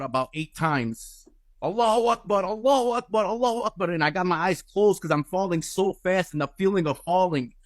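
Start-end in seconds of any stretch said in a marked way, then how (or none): 1.6-3.9 high, hammed-up desperate cry; mock terrifying scream. Falling man screaming to God, high mock scream. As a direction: recreate the scream, much higher. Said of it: "the most terrifying scream you can ever imagine".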